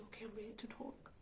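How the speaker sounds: whispered, barely there, between tears; tearful whisper. Retold or imitated. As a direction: breathe the words out as if holding back tears. Retold after crying.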